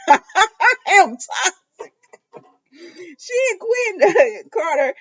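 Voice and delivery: high, slow, giggling correction; laughing through words. Real, not acted. Vlogger correcting her slip while laughing.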